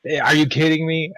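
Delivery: ordinary delivery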